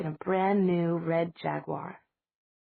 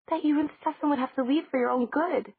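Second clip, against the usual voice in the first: put-on high girl voice; 8 semitones higher, 4 dB louder than usual. Bonnie repeats the news.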